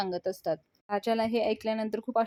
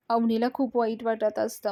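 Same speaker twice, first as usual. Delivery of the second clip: normal talking voice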